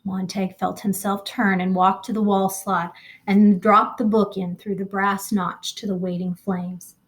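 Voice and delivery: usual speaking voice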